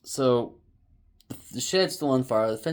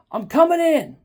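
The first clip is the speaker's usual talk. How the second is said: very loud, high announcement; retold shout; 11 dB louder than usual. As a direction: imitate hollering a warning before barging in. Retold shouting into neighbor's house.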